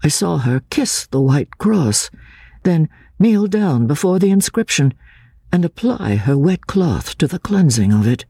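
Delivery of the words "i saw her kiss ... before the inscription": ordinary delivery, nothing marked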